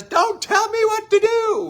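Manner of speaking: a hammed-up furious wife cutting him off; angry wife. Wife snaps at husband giving advice, shrill mock anger. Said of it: "And she says".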